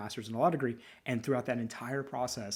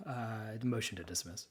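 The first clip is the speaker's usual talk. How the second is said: glum punchline; 6 dB quieter than usual. Retold or imitated. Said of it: "it's like".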